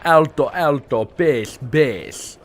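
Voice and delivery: hammy fake Italian accent; bad Italian accent. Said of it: "What kind of accent is that? It's disgusting".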